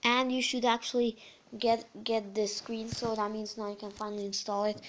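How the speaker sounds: ordinary talk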